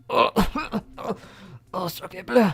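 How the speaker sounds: mock French dying gasp; overacted death scene. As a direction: ham up a dying farewell. Hammed-up dying Pokemon voice.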